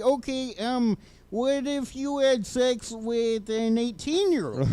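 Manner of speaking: put-on high, slow Minnesota accent, drawn out; exaggerated Minnesota accent, stretched vowels. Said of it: "Your Minnesota accent is appalling".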